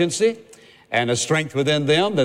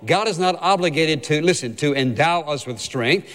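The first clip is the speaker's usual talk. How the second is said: normal talking voice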